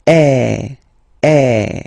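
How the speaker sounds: long then shorter open eh; insisted open eh. Shown as a demonstration. Teacher showing long then short è. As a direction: hold the eh, then clip it. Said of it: "don't be afraid to insist a little bit on the pronunciation".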